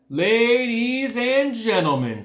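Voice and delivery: high, slow showman call; grand announcer. A narrator's reading. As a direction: stretch it out like a fair announcer. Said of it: "said the loudspeaker".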